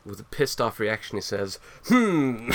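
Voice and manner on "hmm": Morshu impression. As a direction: grunt it like the Zelda shopkeeper. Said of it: "He does a Morshu impression".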